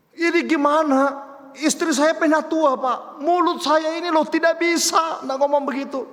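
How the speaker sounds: high, loud, helpless complaint; exasperated husband. Retold or imitated. Husband confessing his foul mouth to the pastor, exasperated. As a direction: act out a husband at his wit's end.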